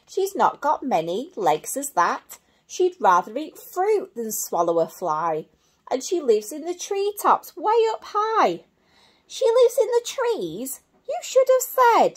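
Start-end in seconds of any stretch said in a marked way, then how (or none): 5.9-12.1 butterfly voice. Acted. Butterfly excited about treetops.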